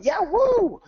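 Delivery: high, loud celebratory whoop; imitated cheering. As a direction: cheer like a team high-fiving. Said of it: "cheering and going".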